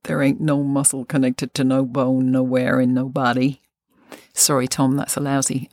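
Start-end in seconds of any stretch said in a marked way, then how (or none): 0.0-3.6 put-on American accent. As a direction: imitate an American, badly. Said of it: "in an American accent".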